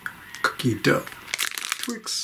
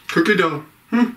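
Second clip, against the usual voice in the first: talking with food in mouth; chewing while talking. Real, not acted. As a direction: mouth partly full. Seen 2: Holds opened bar wrapper; lips pursed, cheeks working as if chewing.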